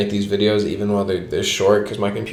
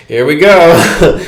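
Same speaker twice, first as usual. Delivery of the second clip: mock dramatic; 5 semitones higher, 9 dB louder, much slower than usual. Bracing before opening door.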